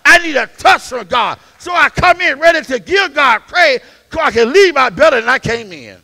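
loud preaching climbing to shouts; preaching at full force. Acted. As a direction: whoop it up to the congregation.

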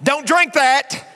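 high, loud nagging wife; husband nagged by his wife, shouting. Acted. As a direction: scold him loudly.

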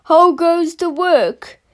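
lightly voiced lazy farmer calling out; a hint of the farmer's voice. A narrator's reading. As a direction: call it out like a lazy farmer from bed. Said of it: "called the farmer".

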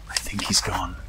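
hushed unvoiced whisper. Real, not acted. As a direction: whisper so he can't hear. Hiding from monster.

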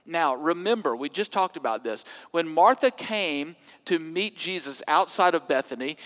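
ordinary delivery

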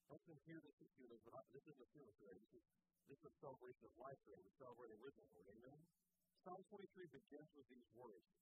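ordinary talk, nothing marked

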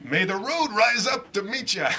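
slow, lilting mock-Irish blessing; hammed-up Irish accent; terrible Irish accent. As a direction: draw out a toast in a bad Irish brogue. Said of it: "the worst Irish accent you ever heard".

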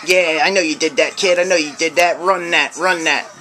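a lightly voiced, menacing holiday monster; Krampus voice. Vlogger playing Krampus threatening a kid who stole money, lightly menacing. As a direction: play Krampus scolding a naughty kid, mostly in his own voice. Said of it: "That's my Krampus voice".

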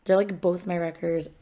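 normal talking voice

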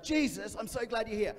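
a frantic dad at his wits' end; desperate father. Retold or imitated. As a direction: plead as a worried father. Desperate father voiced in story.

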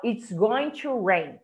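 "It's going to rain" said slowly; slow model phrase. Shown as a demonstration. Teacher models "It's going to rain" slowly. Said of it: "você fala".

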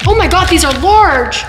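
real surprise at the size; surprised. Real, not acted.

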